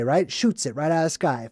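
ordinary delivery

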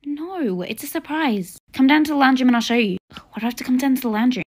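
ordinary talk

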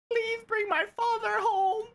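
mock-tearful begging, breaking into laughter; hammed-up plea that climbs to a high wail; pleading kid. Kid begging teacher to bring his father home, mock pleading. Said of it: "the kid's like".

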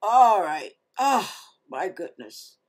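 ordinary delivery

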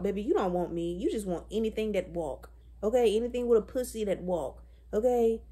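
ordinary talk

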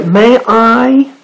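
high, eager little sailor voice; high rabbit voice. Acted. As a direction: squeak it out like a small rabbit. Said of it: "said the sailor".

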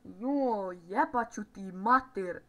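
loud, drawn-out swearing; angry cursing. Real, not acted. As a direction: curse loudly and slowly at the game. Swears after conceding a goal.

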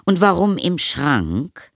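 high, slow, sceptical motherly question. A narrator's reading. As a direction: draw out the question to a child with a secret. Mother questioning her son. (skeptisch fragende Mutter)

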